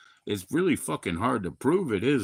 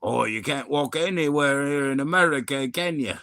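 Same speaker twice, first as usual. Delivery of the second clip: higher-pitched Aussie complaint; mock Australian voice, raised in pitch; Australian accent; 5 semitones higher than usual. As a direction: imitate an Aussie brother, pitched up. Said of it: "his brother was like".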